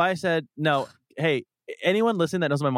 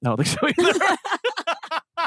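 mock squeaky agreement; 13 semitones higher, much slower than usual. Real, not acted.

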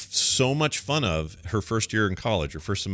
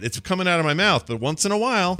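normal talking voice

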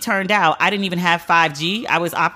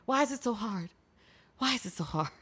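much softer, defeated asking; exasperated; 13 dB quieter than usual. Real, not acted. Host despairing at people ignoring doctors, much softer. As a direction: sag into the question.

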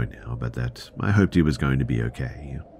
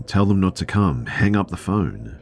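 mild retold yell, higher and louder; 6 semitones higher, 4 dB louder than usual. Panicked man to driver, retold yelling. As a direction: raise your voice in a contained angry order. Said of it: "He starts yelling".

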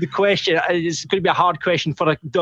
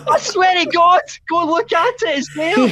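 laughing; 13 semitones higher, 4 dB louder than usual. Real, not acted. Laughing insistence.

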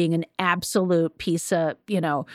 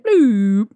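reset button bloop; 6 dB louder than usual. Pretend. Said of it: "be like".

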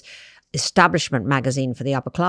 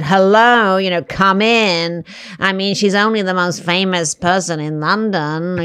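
high, slow, loud wail through the nose; drawn-out nasal wail of a gossip; whining nasal voice; 5 semitones higher, 6 dB louder, slower than usual. Retold or imitated. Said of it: "it was this wailing voice".

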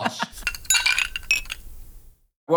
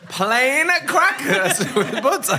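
high, slow, laughing through the words; giggling disbelief at the cracker order; 9 semitones higher, much slower than usual. Real, not acted. Mocking friend's cracker rider.

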